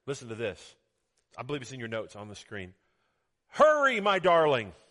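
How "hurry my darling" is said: loud, high call to a darling; urgent call. Acted. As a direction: call out urgently to your beloved. Reads the Lord's urgent call.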